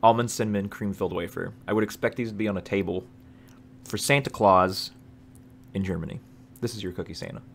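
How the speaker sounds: talking between bites; chewing while talking. Real, not acted. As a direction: mouth full of wafer. Seen 0: Holds wafer at lips, bites and chews between and during words.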